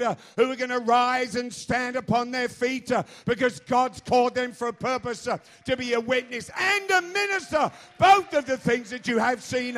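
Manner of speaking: loud, high, shouted sermon with uh breaths; preaching climax. Acted. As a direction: drive the crowd to amen.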